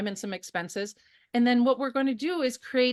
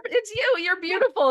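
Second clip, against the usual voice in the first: tender reassurance; 11 semitones higher, 4 dB louder than usual. Retold or imitated. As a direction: reassure her gently, drawing it out. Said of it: "And I said".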